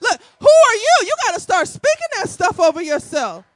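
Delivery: high, loud challenge to herself; loud self pep talk. Retold or imitated. Preacher scolding herself, loud and high-pitched. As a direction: call herself out loudly, half laughing. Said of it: "I'm gonna be like".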